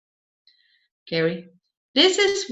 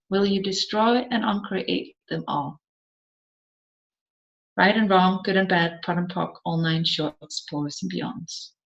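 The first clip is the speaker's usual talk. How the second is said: recited clearing statement; 2 semitones lower, 5 dB quieter than usual. Acted. Facilitator reciting the clearing statement to the class, fading rote chant. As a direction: run the clearing words, trailing away quietly.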